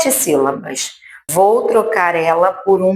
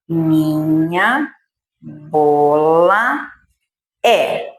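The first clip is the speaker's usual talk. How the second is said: drawn-out words, lower voice; slow word-by-word reading; 4 semitones lower, much slower than usual. Shown as a demonstration. Teacher rereading the sentence slowly at the board. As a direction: read each word slowly as you point. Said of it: "Revisando o marcador aqui".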